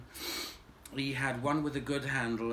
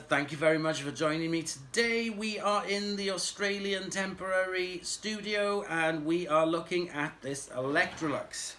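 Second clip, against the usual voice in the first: ordinary talk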